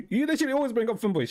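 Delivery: fast, high, laughing as you accuse; giggling blame at a friend; laughing accusation. Real, not acted. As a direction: tease him through a laugh.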